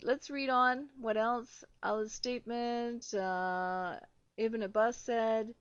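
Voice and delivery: ordinary talk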